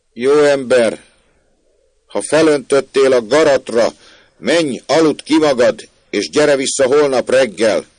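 high, loud telling-off; loud scolding. Acted. Maid sends the drunk away from her window, scolding loudly.

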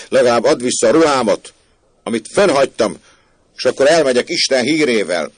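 loud, high, outraged protest; indignant shouting. Acted. Cheated man demands his clothes from the maid, shouting indignantly. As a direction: shout your demand up at the window. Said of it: "kiáltott fel Andreuccio".

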